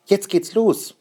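hoch und laut vor Aufregung; aufgeregter Ruf. Acted. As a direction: freudig ankündigen, dass es losgeht. Vater ruft beim Osterfeuer. (aufgeregt, deutlich höher)

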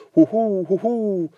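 high hooting owl call. Acted. Owl hoots to the mouse, high and startled. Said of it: "zegt hij".

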